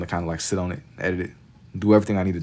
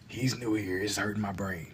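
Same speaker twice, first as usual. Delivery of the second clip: softer aside; 9 dB quieter than usual. Shown as a demonstration.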